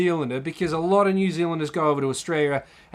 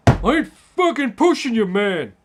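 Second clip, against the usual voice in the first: giant Tongan man; 3 semitones higher, 4 dB louder than usual. Retold or imitated. Tongan man denying pushing him in the bar, heated imitation. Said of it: "this giant Tongan man".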